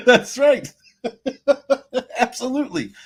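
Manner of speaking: loud, high laughter through the words; laughing. Real, not acted. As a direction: crack up while agreeing. Laughing at joke comment.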